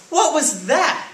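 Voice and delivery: high, loud, slow astonished question; startled cartoon cry; exclaiming. A narrator's reading. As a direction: stretch it out in loud amazement. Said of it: "exclaimed Skippy John Jones".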